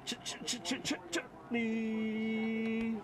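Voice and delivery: breathy stutter, then a slow, high Chutley; stuttered build-up. Pretend. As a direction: stammer the start to build suspense before the name. Hyping up the card name.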